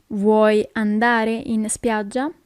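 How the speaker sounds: slow question, every word separate; slow teaching repeat. Shown as a demonstration. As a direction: ask it slowly for learners. Teacher repeats slowly for learners.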